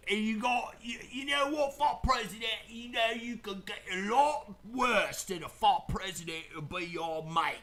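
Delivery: hammed-up bad Cockney toast; Cockney accent. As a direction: ramble like a rowdy Cockney mate. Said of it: "I'll do it in Cockney accent".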